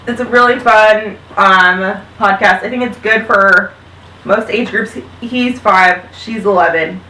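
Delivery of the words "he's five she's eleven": ordinary delivery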